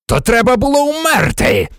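high, forceful shout; furious roar. A narrator's reading. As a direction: roar it at the coward. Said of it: "заревів Блек". (лютий крик високим голосом)

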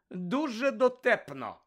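high, slow sarcastic praise. A narrator's reading. Hermione repeating herself to the giggling Slytherin girls, sarcastic. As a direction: stretch it out in mock admiration. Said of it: "саркастично звернулася Герміона".

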